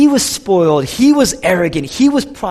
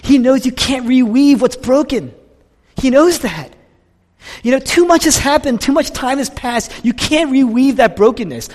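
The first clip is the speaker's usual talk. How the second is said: higher, louder impassioned preaching; 9 semitones higher, 5 dB louder than usual. Acted. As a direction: cry it out to the congregation. Preaching at full force.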